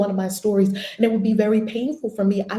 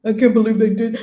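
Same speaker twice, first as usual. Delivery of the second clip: a louder, hammed-up indignant line; mock anger; 3 semitones higher, 7 dB louder than usual. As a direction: play the angry betrayed partner. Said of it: "you're gonna have the anger".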